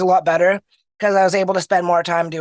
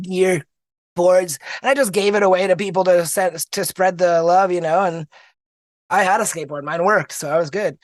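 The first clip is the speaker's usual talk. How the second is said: ordinary delivery, nothing marked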